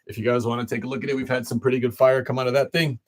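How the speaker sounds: ordinary talk, nothing marked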